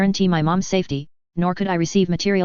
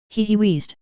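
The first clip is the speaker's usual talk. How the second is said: plain reading voice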